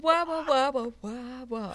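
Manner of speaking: high loud muffled wah wah sounds; muffled wah wah talk. Retold or imitated. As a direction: mimic a muffled voice going wah wah. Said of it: "sounded like [name] just popped into the background".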